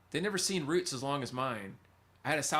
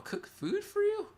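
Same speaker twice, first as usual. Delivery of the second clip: much higher, slow, drawn-out question; amazed; slower than usual. Real, not acted. Streamer reading chat about nurses cooking, amazed. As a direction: stretch it out in delight.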